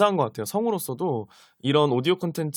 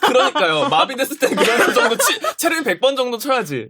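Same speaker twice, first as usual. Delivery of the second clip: higher, louder words through laughter; laughing while talking; 8 semitones higher, 4 dB louder than usual. Real, not acted. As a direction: joke through your own giggles.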